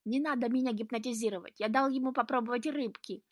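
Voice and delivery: pleading. A narrator's reading. Cornered cat begs not to be hypnotized, pleading.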